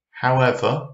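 usual speaking voice

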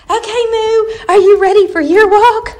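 high, eager voice for a dog; excited pet talk. Real, not acted. Dog mom getting her second dog ready to walk, excited. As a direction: hype the dog up with a lifted pitch.

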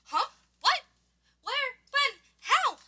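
short, high startled questions; bewildered, high-pitched one-word questions; startled awake. Acted. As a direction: fire off each question separately, startled. Startled sleeper woken up in story.

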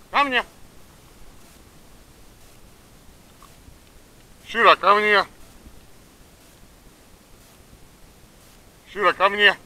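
high-pitched call to a dog. Real, not acted. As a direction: call her back, voice up high. Calling dog from distance.

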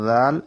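crisp zal sound; Arabic letter zal. Shown as a demonstration. Teacher demonstrating the letter জাল, crisp. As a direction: say জাল with the tongue between the teeth. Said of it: "জালের জায়গায় জা বললে হবে না".

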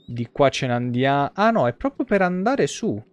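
ordinary talk

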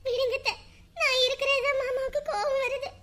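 high, plaintive crying; tearful resignation. Acted. Wife offering to leave for mother's house, tearful resignation.

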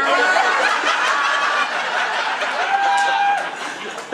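high mocking cackle; long gloating laugh; evil cop cackle. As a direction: cackle like you just won. Cop's laugh after taunt.

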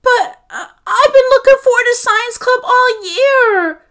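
high, loud, near-tearful wail; rising upset cry. A narrator's reading. Boy protesting to his mother, voice rising near tears. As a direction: let the voice climb, fighting tears. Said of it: "Michael's voice rose".

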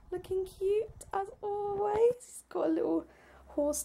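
soft, high-pitched pet voice; dog talk. Real, not acted. Showing viewers the cute little dog, soft high dog talk. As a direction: coo quietly and high over a little dog. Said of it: "they do this really high-pitched".

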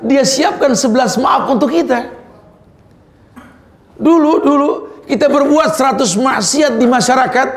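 high, emphatic sermon voice; impassioned preaching. Acted. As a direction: preach it insistently, pitched well up.

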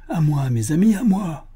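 loud, high-pitched summons; rallying cry. A narrator's reading. Buffalo Bill summoning friends to the chase, urgent rallying cry. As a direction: call your friends to your side. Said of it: "cria Buffalo Bill, très ému".

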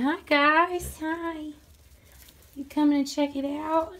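high, sweet greeting to the animals. Real, not acted. To the barn animals as she walks in, high sweet greeting.

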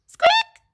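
sudden high squeaky peep; tiny squeak. Acted. Allosaur trying to roar, a tiny high squeak. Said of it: "his roar became a".

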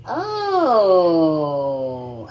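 loud sudden exclamation; surprised oh. Real, not acted. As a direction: let out a startled oh.

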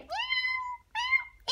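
put-on squeaky kid's cat meow; high mock meow. Frog son shows off his meow in a high squeak. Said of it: "I do a good meow".

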